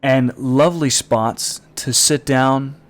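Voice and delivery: loud innuendo. Retold or imitated. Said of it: "innuendo in salesman voice".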